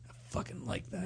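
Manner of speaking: breathy retold whisper of a turned-on girl. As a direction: hush it like a secret in the hallway. Girl's whispered reply in hallway.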